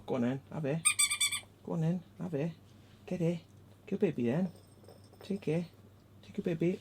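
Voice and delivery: soft, high coaxing; high little pet-owner voice. Real, not acted. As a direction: urge a pet to take its toy. Offering dog its toy.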